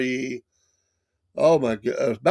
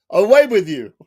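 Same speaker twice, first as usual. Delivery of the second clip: mock royal dismissal; 6 semitones higher, 8 dB louder than usual.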